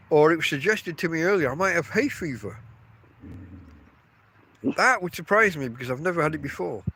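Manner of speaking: ordinary talk, nothing marked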